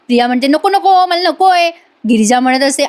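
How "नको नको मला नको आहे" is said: higher-pitched mock protest; imitated reluctant refusal. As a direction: push it away with a raised pitch. Acting out Priya refusing again.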